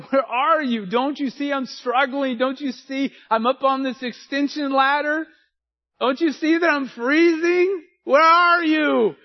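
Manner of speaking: a petulant believer's mock lament; whining plea to God. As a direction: complain to God like you're stuck and freezing. Mock prayer of a complaining believer.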